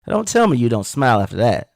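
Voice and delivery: ordinary talk, nothing marked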